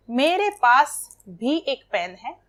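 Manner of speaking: slow, with भी pushed out clearly; stress on भी. Shown as a demonstration. Teacher demonstrates the particle भी to students, slow stress on भी. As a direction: linger on भी and hit it harder. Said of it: "भी पर जोर दिए कर बोल रहे हैं".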